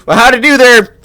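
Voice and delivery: high, loud, chatty country baker; bright eager shop owner; folksy baker voice. Acted.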